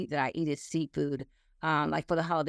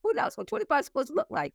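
fast, much higher mock question; incredulous retort; 10 semitones higher, faster than usual. Real, not acted. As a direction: fire it back in a high, amused pitch. Retorting to age compliments.

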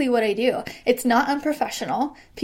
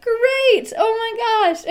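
much higher and louder, then a giggle; thrilled; 11 semitones higher, 5 dB louder than usual. Retold or imitated. Clerk reacting to a customer signing up, delighted. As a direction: squeal with joy at the sale.

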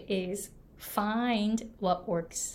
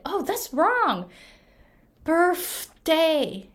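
higher, slow mock correction of the word; 7 semitones higher, 5 dB louder, much slower than usual. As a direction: play the person insisting it's wrong. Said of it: "another person said".

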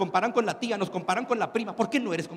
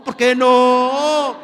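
drawn-out shout; 4 semitones higher, 11 dB louder, much slower than usual. Retold or imitated. Parent pushing child to export.

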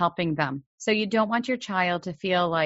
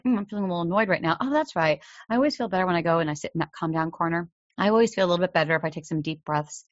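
rattled off fast, like a rehearsed script; imitating a well-regulated kid talking to himself, quick; calm child's self-talk; faster than usual. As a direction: hurry through it in a light, self-soothing tone. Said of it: "be like".

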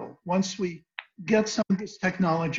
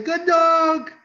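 very high, gushing praise for a dog; sing-song high voice for greeting a pet; high-pitched dog talk; 9 semitones higher, 4 dB louder than usual. Shown as a demonstration. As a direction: coo loudly to the dog, high and drawn out. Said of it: "in a high-pitched voice".

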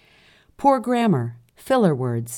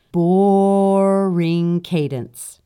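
very slow, stretched Boring cadence, louder; drawn-out boring; 3 semitones higher, 5 dB louder, much slower than usual. Retold or imitated. Quoting a listener's complaint, a long stretched boring. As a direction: hold the O in Boring for ages. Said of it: "I counted the O's in that boring, and there were eleven".

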